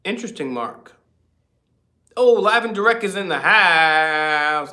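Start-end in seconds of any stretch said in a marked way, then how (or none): 2.2-4.7 loud, stretched-out hype announcement; hype shout-out. Real, not acted. Streamer greeting a viewer joining chat, hype shout-out. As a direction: hail them loudly, dragging out the last word.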